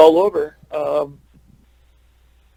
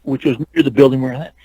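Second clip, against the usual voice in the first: ordinary talk, nothing marked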